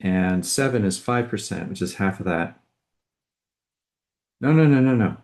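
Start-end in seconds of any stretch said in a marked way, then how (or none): 4.4-5.2 alarmed no. Real, not acted. Catches her own mistake.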